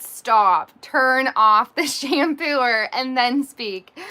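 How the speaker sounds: loud, slow, firm command to stop; stern order. Retold or imitated. Wife ordering husband to stop, loud and slow. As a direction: lay down the law, word by word.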